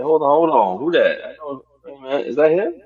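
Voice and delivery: high, excited double-take; re-enacted surprise, loud at the start; startled recognition. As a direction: act out suddenly spotting someone you know. Acts out own startled recognition on screen.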